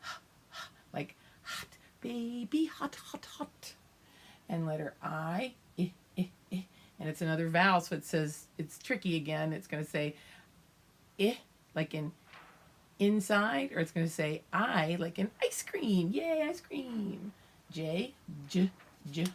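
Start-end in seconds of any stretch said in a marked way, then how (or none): 0.5-3.7 "H" as a breathy unvoiced huh; h sound. Shown as a demonstration. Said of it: "And H is H". 16.1-17.3 slow, high delighted cheer; excited. Real, not acted. Teacher cheers about ice cream.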